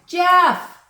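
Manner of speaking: high, loud shout of a name; calling out. Acted. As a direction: yell across the garden. Calling out to Jeff.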